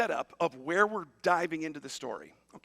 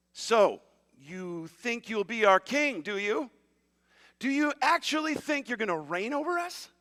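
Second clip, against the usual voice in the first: mock incredulity; 5 semitones higher, 4 dB louder than usual. Brothers answering the dreamer who would be king, scoffing disbelief. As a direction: challenge him in acted disbelief.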